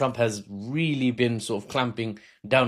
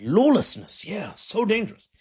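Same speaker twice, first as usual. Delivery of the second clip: higher, mock-alarmed aside; sarcastic; 8 semitones higher than usual. Analyst doubting Trump's rampant crime claim, sarcastic. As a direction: feign alarm at the danger.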